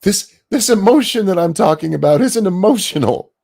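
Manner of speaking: high, loud parody of a furious rant; mock screaming. Host imitating the woman screaming at this man, loud high mock fury. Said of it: "she's just screaming at this man".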